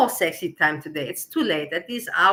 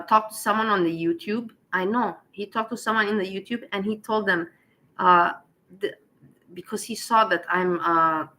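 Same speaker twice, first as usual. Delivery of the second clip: normal talking voice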